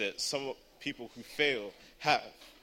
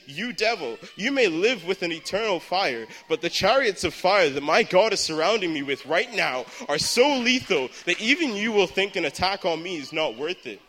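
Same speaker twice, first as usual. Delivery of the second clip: raised, high-pitched defiant rebuke; crying out at the devil; 4 semitones higher, 6 dB louder than usual. Retold or imitated. Exam-taker confronting the devil over fear, crying out defiantly. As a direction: cry it out like rebuking an enemy. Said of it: "screaming out".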